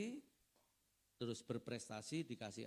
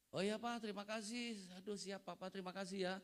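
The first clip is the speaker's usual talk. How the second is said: relieved thanks; 9 semitones higher than usual. Retold or imitated. Relieved son thanks father.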